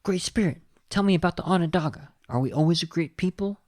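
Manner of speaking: a hint of Little Bear asking the spirit; quoting Little Bear. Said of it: "and I quote".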